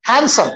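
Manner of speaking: "Handsome" as HAN-sum; silent D. Shown as a demonstration. Teacher answering how to pronounce it, "Handsome" with silent D. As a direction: leave the D out of "Handsome". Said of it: "डी लिखा हुआ है".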